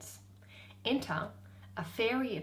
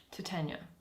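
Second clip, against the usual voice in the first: normal talking voice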